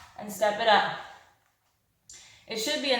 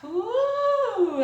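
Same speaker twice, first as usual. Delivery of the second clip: excited whoop; 11 semitones higher, 3 dB louder than usual. Real, not acted. Cheering class on during flow.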